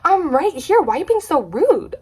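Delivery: high, upset protest; mock offended whine; hammed-up indignation; indignant. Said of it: "She was like".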